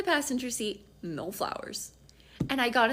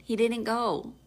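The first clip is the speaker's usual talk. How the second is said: usual speaking voice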